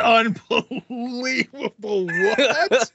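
drawn-out, high stunned disbelief; mock disbelief. Real, not acted. Host hearing his co-host is banned forever, stunned. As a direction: act floored by the news. Host reacts to lifetime ban news.

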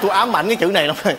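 normal talking voice

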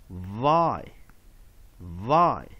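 "vi" said like "vye", isolated and drawn out; long vowel I. Shown as a demonstration. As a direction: model "vi" with a long I, pausing after each. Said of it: "long vowel I".

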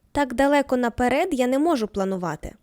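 usual speaking voice

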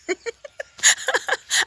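high, airy burst of laughter; real giggle. Real, not acted. Laughs at own remark on calm.

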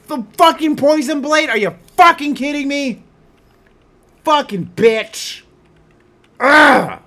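high, loud screaming rage; real fury at the enemy; full-throated gamer rage; furious shout. Real, not acted. Raging after dying to bandits.

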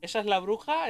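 ordinary delivery

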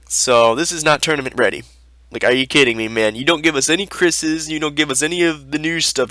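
ordinary delivery

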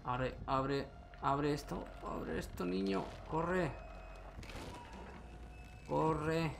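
rising panicked urging. Real, not acted. Fleeing, door blocked.

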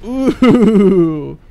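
long, loud "ooh"; excited "ooh". Real, not acted. Player loving episode one's best part, excited loud ooh. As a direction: whoop with delight. Delighted by the game.

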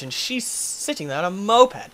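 ordinary delivery

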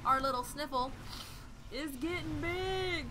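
rising into a high, loud yay; excited cheer. Real, not acted. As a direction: cheer as the Pokemon grows big. Cheering as Pokemon grows.

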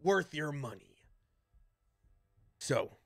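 lightly voiced gruff band leader giving orders; Voltar. Game master voices Voltar.

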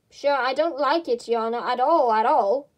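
usual speaking voice

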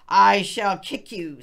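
loud, laughing mock threat; hammed-up angry rabbit; rage. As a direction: bellow it while giggling. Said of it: "said Rabbit in a rage".

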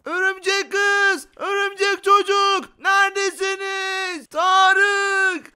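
long drawn-out, high-pitched shouts; calling out. Pretend. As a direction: call slowly for someone lost far away. Calls out for lost family. (uzata uzata seslenme)

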